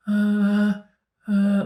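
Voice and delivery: long, high held moans; audible groaning. Retold or imitated. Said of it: "like, audibly like that".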